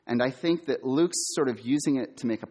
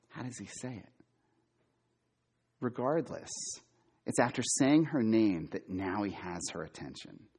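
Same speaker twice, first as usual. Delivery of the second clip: normal talking voice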